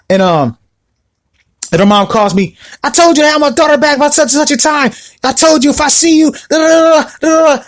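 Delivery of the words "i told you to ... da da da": high, pushed-up scolding mom voice; mocking an irate mother, pitched high; angry mom impression. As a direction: play her mother chewing him out, voiced up high. Angry mom on phone retold.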